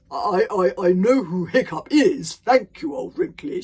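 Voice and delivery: loud, stammering bluster; uncomfortable. Acted. Stoic answers Old Wrinkly about his own son, uncomfortable bluster. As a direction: bluster over your embarrassment. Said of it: "said Stoic the Vast uncomfortably".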